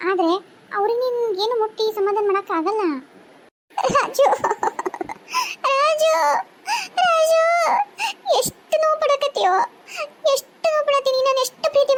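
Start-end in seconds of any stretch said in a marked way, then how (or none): 3.9-11.9 high, crying calls of his name; high tearful lament; weeping calls. Acted. Wife calls husband, weeping.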